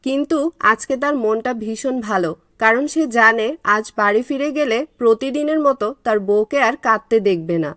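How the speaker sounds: low, even storyteller voice; narrating a village folk tale; story narration. A narrator's reading. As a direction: tell the tale calmly to listeners. Narration resumes.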